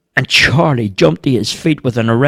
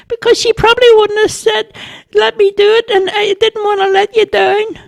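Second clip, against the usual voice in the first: very high, squeaky explanation; earnest little boy; 4 dB louder than usual. A narrator's reading. Boy admitting he didn't want to let Bobo down, earnest. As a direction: explain yourself in a piping child voice.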